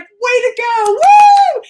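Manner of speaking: high, loud cheer ending in a whoop; retold cheer, rising into a loud woo; cheering crowd. Crowd cheering at finish line.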